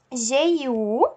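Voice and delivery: ordinary talk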